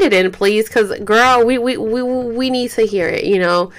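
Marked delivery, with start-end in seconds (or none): none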